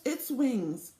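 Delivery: ordinary delivery, nothing marked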